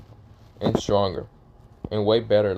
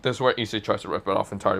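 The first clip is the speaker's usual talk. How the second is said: normal talking voice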